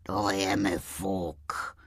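low, soft, slow grumble; grouchy walrus. A narrator's reading. Walrus dismisses the sprite's worries, grouchily. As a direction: grumble it away with no interest. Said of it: "mrzoutil se mrož".

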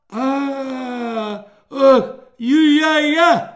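high loud babbling vowel sounds; mumbled gibberish. A narrator's reading. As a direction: babble like a simpleton. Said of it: "zaševelil vojín Čilpan".

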